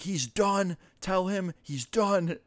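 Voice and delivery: high, slow, drawn-out imitated angry mom; imitating an outraged mom's final word; mock furious verdict. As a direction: lay down the final sentence like a fuming parent. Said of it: "you could hear her screaming".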